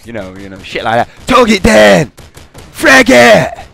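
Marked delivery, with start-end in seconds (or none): 1.3-3.7 much higher, loud barked combat callout; shouted soldier callout. Retold or imitated. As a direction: yell it like a game soldier in a firefight. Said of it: "they do shit like that".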